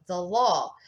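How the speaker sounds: usual speaking voice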